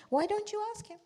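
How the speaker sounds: mock taunting suggestion. Ibrahim taunts the idol worshippers.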